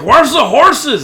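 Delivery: loud, high, drawn-out interrogation; shouted demand. Acted. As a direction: yell it while shaking the kid. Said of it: "he shakes him a little bit".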